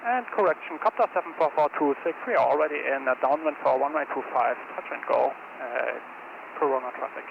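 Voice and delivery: quiet radio transmission voice; radio call. Shown as a demonstration. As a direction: read it like a pilot on the radio. Instructor corrects radio call.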